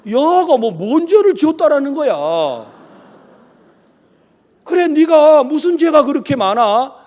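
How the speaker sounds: high, loud incredulous questioning; wide-eyed disbelief. Retold or imitated. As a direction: imitate a stunned aunt challenging the confession. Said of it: "막 눈이 동그래지더라고".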